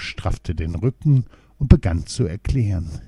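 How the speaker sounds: ordinary delivery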